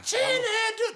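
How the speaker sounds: high drawn-out parent call; calling out name. Retold or imitated. Parent calling the kid from another room, long high call.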